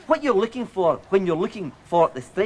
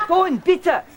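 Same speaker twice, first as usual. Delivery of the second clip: sharp yell pitched way up; angry outburst; 9 semitones higher, 4 dB louder than usual. Acted. Presenter yelling at crew between takes, loud and high. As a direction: snap at the crew to shut it.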